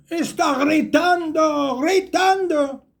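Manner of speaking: high, drawn-out cry; amazed exclamation. Acted. Storyteller showing a man shouting from a high place, high and drawn out. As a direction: stretch each word high in wonder.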